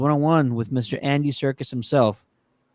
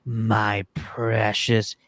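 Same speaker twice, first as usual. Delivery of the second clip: very slow imitated creature voice; Gollum; 3 semitones lower, 3 dB quieter, much slower than usual. Retelling actor scaring his son, slow imitated Gollum voice. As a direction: draw it out slowly like an eerie creature. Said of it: "he starts talking in his Gollum voice".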